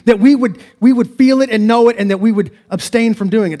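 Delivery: loud, high climactic preaching; preaching fervor. Acted. Preacher urging the congregation to feel and abstain, loud. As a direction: build it to a fervent peak.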